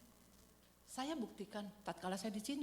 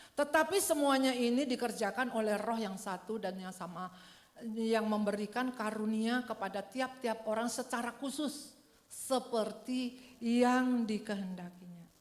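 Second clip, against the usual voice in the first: louder, slowing scripture read; solemn scripture reading; 2 semitones higher, 6 dB louder than usual. Acted. Preacher reads Corinthians to the church, loud and solemn. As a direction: read the verse aloud with weight.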